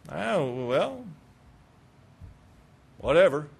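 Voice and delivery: low, offhand shrug of a reply; softly dismissive; indifferent. Acted. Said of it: "it's kind of indifferent".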